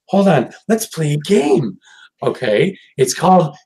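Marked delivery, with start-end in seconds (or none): 0.1-1.8 gentle high coaxing voice; lightly voiced sweet pony; sing-song Fluttershy. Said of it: "and it's like".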